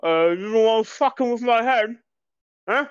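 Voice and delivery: talking through a mouthful of steak; aggressive, muffled, chewing; mouth full. Acted. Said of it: "he responds back".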